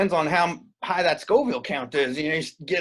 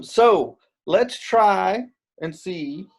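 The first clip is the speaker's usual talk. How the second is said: ordinary delivery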